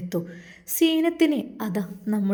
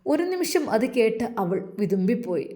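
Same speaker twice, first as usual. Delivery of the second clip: plain reading voice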